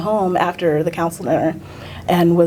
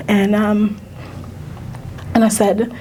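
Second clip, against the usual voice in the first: ordinary talk, nothing marked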